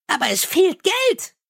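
high, chirpy little imp. Acted. Imp soothes the angry Mumm, high and chirpy. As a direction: pipe it up high like a tiny helpful imp.

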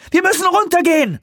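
sehr hoch geschrien. Acted. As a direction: schrei es hoch hinaus. Weaver schreit weiter. (hoher Schrei Weavers)

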